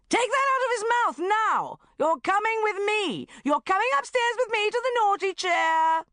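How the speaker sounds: put-on high furious yell at a child; angry shout. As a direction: scream it like a mother at the end of her rope. Furious mother scolding child.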